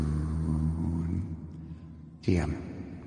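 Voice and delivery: low, soft cryptic tease; mysterious low voice. Acted. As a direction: drop low like a secret. Teasing a mysterious answer.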